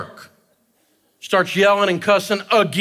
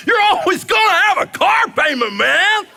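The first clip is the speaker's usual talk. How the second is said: much higher, louder, cheering it out; celebratory; 12 semitones higher, 3 dB louder than usual. Shown as a demonstration. Comedian mocking debtors, in a mock celebratory cheer. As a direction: sell it like great news. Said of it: "in a celebratory voice".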